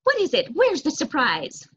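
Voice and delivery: high, loud excited questioning; eager excitement. A narrator's reading. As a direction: ask eagerly, like a child after a treat. Eager child asks for surprise.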